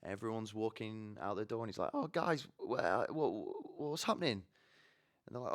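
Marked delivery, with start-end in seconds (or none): 1.9-4.4 high-pitched, stammering confusion; bewildered latecomer tripping over words; flustered latecomer. Acted. Late Rishi flustered at meeting.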